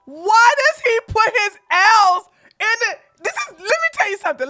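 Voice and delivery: loud, high, laughing through words. Real, not acted. Streamer losing it laughing at the video, loud and high. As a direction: crack up mid-sentence.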